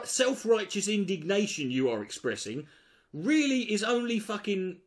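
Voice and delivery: normal talking voice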